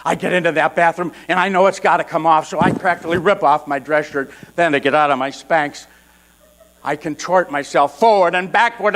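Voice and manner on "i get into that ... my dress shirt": usual speaking voice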